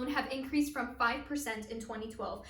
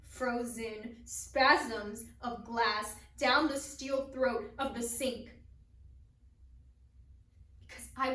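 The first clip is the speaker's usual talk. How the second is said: halting, hushed verse with long pauses; poem recital; 4 dB quieter than usual. Acted. Performer recites a poem of a shattered dream, halting poem recital.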